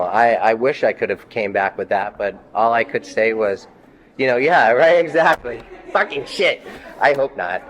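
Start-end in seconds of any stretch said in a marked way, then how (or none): 4.2-7.6 laughing out the words, pitch jumping up and louder; real worked-up laughter over the memory; emotional laughing. Real, not acted. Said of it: "I'm emotional".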